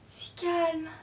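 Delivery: soft, high, held tone; thin, suspended high murmur; eerie hush. Acted. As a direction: float it high and quiet. Hush before last cry.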